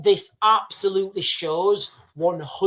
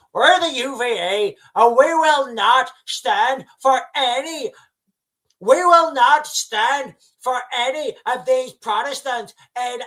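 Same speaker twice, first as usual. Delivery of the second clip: raised, forceful Northern Irish political speech; Irish Republican accent; 5 semitones higher than usual. Acted. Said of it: "Irish Republican accent".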